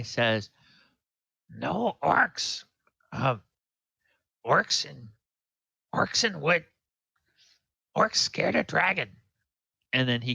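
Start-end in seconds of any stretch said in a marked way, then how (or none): 1.5-9.1 halting goblin voice, pitched up; goblin prisoner. Acted. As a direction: play a simple goblin in broken phrases.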